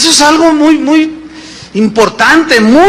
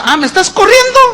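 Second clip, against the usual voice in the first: proud retort; 11 semitones higher, faster than usual. Acted. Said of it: "la otra también bien orgullosa".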